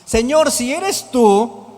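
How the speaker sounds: loud, slow, drawn-out plea; bold, projected challenge in slow words; Peter calling out. Acted. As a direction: call across the water to him. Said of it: "respondió Pedro".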